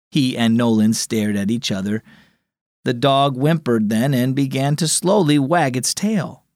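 ordinary delivery, nothing marked

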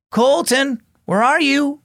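high-pitched call across the house; big brother calling through the house. A narrator's reading. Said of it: "Ian called".

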